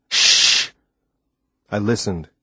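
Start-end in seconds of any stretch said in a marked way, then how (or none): 0.1-0.7 sharp hushing "shh"; urgent shush. A narrator's reading. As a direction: hush your companion to listen. Hushing companion to listen.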